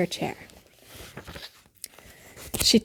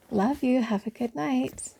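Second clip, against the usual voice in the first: soft goodnight; 4 semitones higher, 6 dB quieter, slower than usual. Real, not acted. Bedtime goodnight to child.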